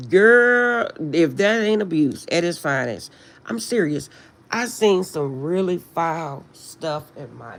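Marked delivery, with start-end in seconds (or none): 0.1-3.1 put-on loud, high drawn-out girl; incredulous girl. Reacting to a friend's story, a loud high incredulous girl. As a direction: call out girl in disbelief at the abuse. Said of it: "I'm looking like".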